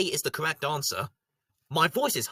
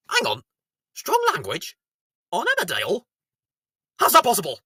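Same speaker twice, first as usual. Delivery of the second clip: mock disbelief; 5 dB louder, faster than usual. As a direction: ask it in mock shock.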